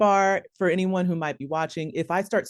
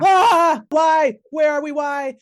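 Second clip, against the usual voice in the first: high, loud hammed-up wail; play-acted frantic, high shouting; acted screaming; 10 semitones higher, 9 dB louder, slower than usual. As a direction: scream it in mock panic. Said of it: "I'd just be screaming".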